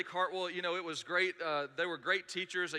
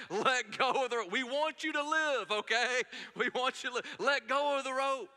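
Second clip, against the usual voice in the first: high, loud pleading shout; urgent high shouting from the boat; people yelling; 6 semitones higher, 3 dB louder than usual. Retold or imitated. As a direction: beg him to let go of the rope. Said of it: "literally yelling at me".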